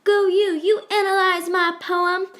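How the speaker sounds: high, cheerful cheering voice; cheery Shakespeare cartoon. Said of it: "Happy Shakespeare in the corner saying".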